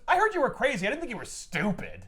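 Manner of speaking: high mock scolding; a lightly voiced snappy put-down; hint of an exasperated wife; Anne's retort. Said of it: "she goes".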